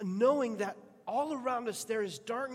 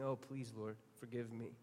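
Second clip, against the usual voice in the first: soft, pleading prayer, much softer; a whispered-down prayer for forgiveness; hushed plea; 9 semitones lower, 12 dB quieter than usual. Retold or imitated. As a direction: beg God quietly. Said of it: "come before God saying".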